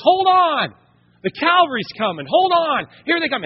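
excited battle cry, high and loud; rallying shout. Retold or imitated. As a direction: shout across a battlefield as rescue arrives. Cavalry arriving, people calling out.

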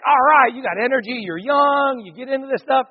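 high, eager pep; youthful pep talk. Retold or imitated. Recalling being young working with teens, high peppy enthusiasm. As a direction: pitch it up like an energetic youth worker. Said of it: "It was like".

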